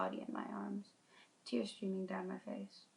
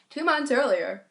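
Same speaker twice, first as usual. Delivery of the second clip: dramatic heading; 6 semitones higher, 14 dB louder than usual. A narrator's reading. Dramatic chapter heading read out.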